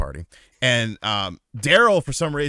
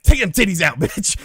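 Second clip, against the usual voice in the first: louder, higher bossy command; mock loud order; 8 semitones higher, 7 dB louder than usual. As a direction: bark it like a cocky boyfriend. Daryl ordering girlfriend.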